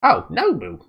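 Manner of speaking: put-on high, loud, failed British accent; botched British accent. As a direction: attempt a British accent and miss it. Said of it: "that's not English".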